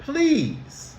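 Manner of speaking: "Please." lifted high and drawn out; sweet please. Shown as a demonstration. Teacher modelling a sweet "Please." for the class. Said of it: "She says".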